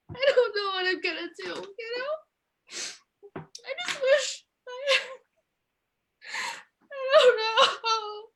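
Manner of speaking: fake-sob through the lines like a struggling shop owner; mock crying. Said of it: "I'm a little emotional".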